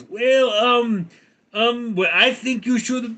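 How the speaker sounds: high, hesitant, anxious stammering; nervous advisor. Acted. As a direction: stall nervously before a dictator. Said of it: "And, and the advisor goes".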